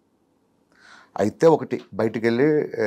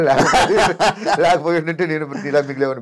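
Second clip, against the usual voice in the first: laughing; 4 semitones higher, slower than usual. Real, not acted. Joking he'd still be single.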